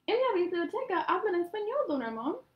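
high, loud proud announcement; excited declaring. A narrator's reading. Boy declaring the news to the grocer, excited. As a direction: announce it to the whole shop. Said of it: "declaró Hildemar".

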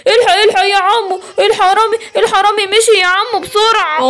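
put-on high urgent kid voice; a small child crying out for help; alarmed child. Kid warning the policeman the thief fled, high and urgent.

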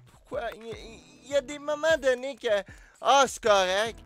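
loud, high frustrated rant; ranting gamer. Real, not acted. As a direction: vent at the game. Ranting about game.

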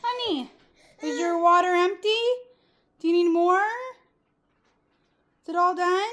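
high, sweet voice for a little one. Real, not acted. Mom talking to toddler.

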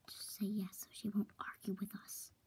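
hushed, quick conspiratorial whisper; secret whisper. Real, not acted. Friends plot in secret about the visitor, whispering. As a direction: whisper fast so she won't hear.

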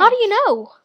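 astonished, almost shouted; surprised demand. Real, not acted. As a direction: blurt it out loudly in surprise. Surprised by news of subscribers.

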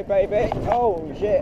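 usual speaking voice

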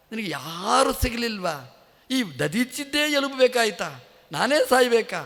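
you can't be serious, me of all people?; incredulous sage protesting to Indra, climbing in pitch; indignant disbelief. Acted.

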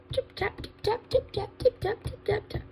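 soft, light tip-tapping; little goat hoofsteps. Acted. Little goat crosses the bridge, soft tapping hoofsteps. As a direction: patter it quietly and crisply like tiny hooves.

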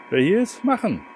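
ordinary talk, nothing marked

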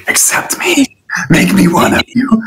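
high, hushed mock begging to be let in; mock pleading. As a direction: beg to join them, half-joking and breathy.

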